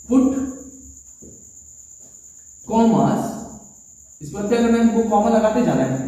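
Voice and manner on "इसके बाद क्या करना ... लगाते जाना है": ordinary talk, nothing marked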